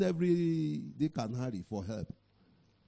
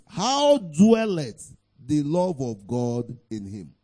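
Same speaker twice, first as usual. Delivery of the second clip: ordinary talk, nothing marked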